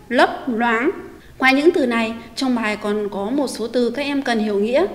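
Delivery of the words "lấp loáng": "lấp loáng" with a clear "l" initial on both syllables; teacher demo of "lấp loáng"; model pronunciation. Shown as a demonstration. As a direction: say "lấp loáng" slowly, each "l" distinct. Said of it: "phát âm đúng âm đầu L".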